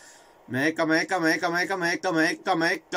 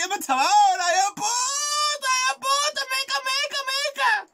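high, strained yelling at the screen; shrieking at the game; frantic screaming. Real, not acted. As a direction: scream it out in disbelief. Goal chaos, screaming at game.

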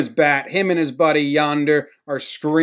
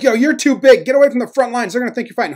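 high-pitched, hurried warning; urgent teammates imitation; 9 semitones higher, 4 dB louder, faster than usual. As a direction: imitate players pulling a big friend back. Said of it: "They're like".

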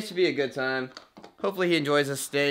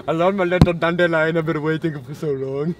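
put-on hammy Indian accent, lovestruck. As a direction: croon it in a mock Indian accent. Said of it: "doing an Indian accent".

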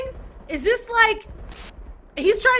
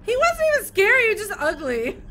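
normal talking voice

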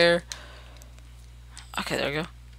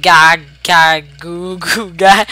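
goo goo ga ga, shouted; loud baby babble; 8 dB louder, much slower than usual. Pretend.

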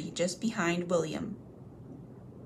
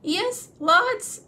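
much higher, loud squeak; frightened blurt; 12 semitones higher, 7 dB louder than usual. Acted. Bilbo dangling by his toes, blurting high and loud.

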